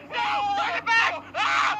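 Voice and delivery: loud, high cartoon screaming; panicked shouting. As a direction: scream in alarm to undo it. Panic at a banana.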